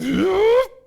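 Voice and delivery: a big, high, loud theatrical gasp; mock gasp. Mock shock at news.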